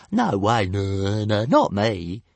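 dragon's innocent denial; little dragon denying. A narrator's reading. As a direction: protest too much.

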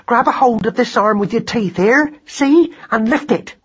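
high, pressing mother's orders; impatient high-voiced coaching; urgent instructing. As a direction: direct your son urgently, pitched high.

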